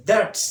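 loud "That's" ending in a crisp s; modelling "That's". Shown as a demonstration. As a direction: say "That's" with a sharp s. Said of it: "দন্তুস্ব হবে that এর সাথে".

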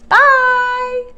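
high, loud sung-out farewell; calling out. A narrator's reading. Annie calling after the loping dinosaur, high loud call. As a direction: call it after someone leaving.

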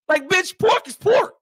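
high, loud protest; exasperated retort. Acted. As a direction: insist it as if stating the obvious. Exasperated retort to retold claim.

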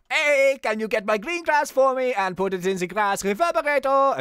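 high, loud, badly faked Mexican accent; terrible Mexican accent. Rocky sending the detective for grass, awful Mexican accent. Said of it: "That's my worst accent to date".

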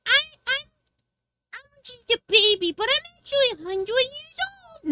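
high, fast mock little-girl voice; childish baby voice. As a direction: squeak it like a fake child. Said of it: "it's like".

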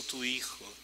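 normal talking voice

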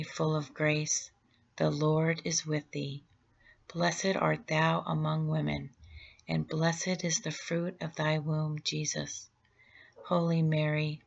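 normal talking voice

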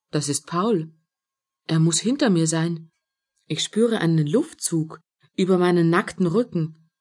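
plain reading voice

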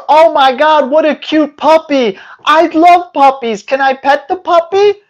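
high, gushing puppy-lover voice; excited neighbor cooing at a new puppy. Retold or imitated. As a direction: coo over the puppy like a smitten neighbor. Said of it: "your neighbor goes".

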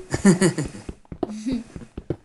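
high giggle; laugh. Real, not acted. Friend laughs at the baby game, high giggle. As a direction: burst out giggling.

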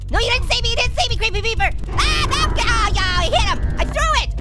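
high, loud frantic yelling; play panic. Pretend. Gamer spotted by Creepy Peeper, panicked yelling. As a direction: yell in mock panic as you're spotted.

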